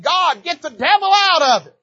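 high, loud desperate cry; the father begging God, loud and high; pleading prayer. Retold or imitated.

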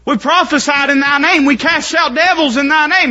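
high, loud pulpit delivery; fervent pulpit preaching; preaching at full force. Acted. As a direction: preach it hard.

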